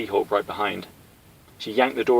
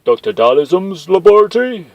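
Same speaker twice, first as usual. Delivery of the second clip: high, loud gruff henchman; eager brute asking the boss; 9 semitones higher, 11 dB louder than usual. Acted. Henchman Zangief sidling up to the boss, high gruff voice.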